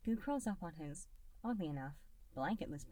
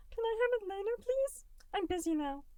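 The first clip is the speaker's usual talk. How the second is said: put-on much higher, louder childlike creature voice; a tiny blob kid, sweet and distracted; little gelatinous Goo; 6 dB louder than usual. A narrator's reading. Little Goo putting off dinner, high and bright. Goo's voice.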